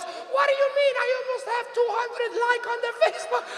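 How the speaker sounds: very high, indignant mom voice; imitating indignant mom. Mother defends her two hundred likes, indignant mom impression. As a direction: protest in mom's high pitch. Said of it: "And she goes".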